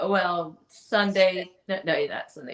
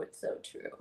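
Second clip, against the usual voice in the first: whispered; 16 dB quieter than usual. Shown as a demonstration.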